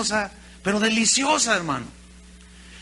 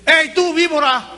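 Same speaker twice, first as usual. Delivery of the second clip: high, loud call across the crowd; single them out with a shout; 8 semitones higher, 7 dB louder than usual. Retold or imitated. John the Baptist shouting at the people in line.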